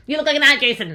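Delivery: high, loud mocking squeal of the insult; mocking Angelica. Vlogger mimics Angelica insulting Jason, loud high mocking squeal. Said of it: "the way that she's like".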